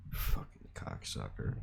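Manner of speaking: very low, hushed grumble; barely voiced, low muttering; muttered insult. Real, not acted. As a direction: curse him under your breath. Grumbled insult after retold quarrel.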